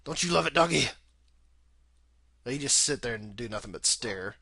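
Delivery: ordinary talk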